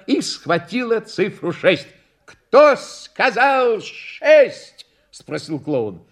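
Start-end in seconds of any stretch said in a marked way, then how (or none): 2.2-4.9 high, loud, very drawn-out question; clown showman. A narrator's reading. Clown asks who called the number, grand showman. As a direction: stretch each word for the crowd.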